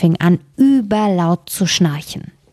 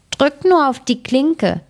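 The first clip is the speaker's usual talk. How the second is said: mild calling out; 8 semitones higher than usual. A narrator's reading. Grandmother calls to the visitor at the door, mild high call. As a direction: call lightly from bed, slow and pitched up. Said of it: "rief die Großmutter".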